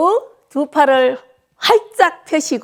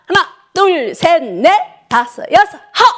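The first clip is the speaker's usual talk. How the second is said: high, louder count with a shouted finish; calling the count; 5 semitones higher, 4 dB louder than usual. Real, not acted. 어르신들 박수에 맞춰 크게 외치는 구령. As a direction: drive the class through the claps. Instructor calls clap count.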